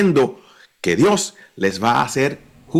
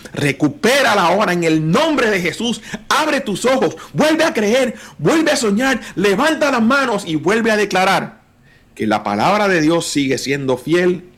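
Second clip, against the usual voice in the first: high-pitched, urgent preaching peak; preaching exhortation; 6 semitones higher than usual. Real, not acted. A preacher urging the congregation to believe and dream, fervent exhortation. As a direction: drive each command home on a high pitch.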